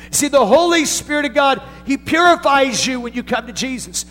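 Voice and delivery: high, loud preacher's cry; full-voiced sermon peak; preaching at full force. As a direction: lift it and preach it out.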